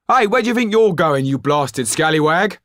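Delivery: very high, loud furious innkeeper; angry shout. A narrator's reading. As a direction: yell after the runaway boy. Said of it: "the man shouted".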